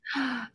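sharp surprised gasp. Real, not acted. Surprised by graduating early news.

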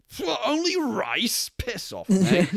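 mocking a proud samurai turning down rice; scoffing samurai. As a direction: sneer at a bowl of rice. Poor samurai scoffing at rice pay.

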